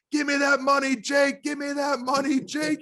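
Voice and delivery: high, sustained hollering chant. Retold or imitated. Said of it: "[name] yelling up".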